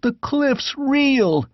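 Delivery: high, slow frightened cry; terrified shriek. A narrator's reading. Squire sees the cliffs collapse, high slow shriek. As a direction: gasp it out in horror. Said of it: "shrieked the squire".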